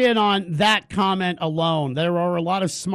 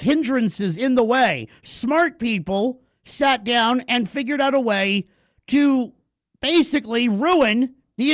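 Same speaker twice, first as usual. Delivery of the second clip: higher, emphatic host's tirade; indignant rant; 3 semitones higher, 3 dB louder than usual. Real, not acted. Radio host condemning smart people behind the cheating scheme, indignant. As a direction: build a forceful case, pitched up and punching the repeats.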